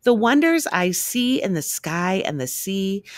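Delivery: plain reading voice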